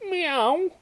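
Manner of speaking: very high rising mew; distressed kitten meow. A narrator's reading. Kitten plopping into the pond, high meow. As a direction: give a high little cat cry.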